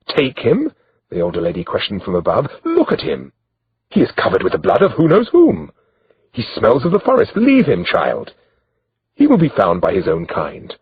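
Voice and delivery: lightly voiced disdainful royal lady; haughty older noblewoman. A narrator's reading. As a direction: brush him off like a lady above it all.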